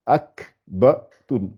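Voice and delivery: "ruakbatun" very slowly, a pause between syllables; syllables separated. Shown as a demonstration. Teacher separating the letters before joining them, slow and segmented. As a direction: break "ruakbatun" into its separate sounds. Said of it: "séparer les lettres et de les assembler".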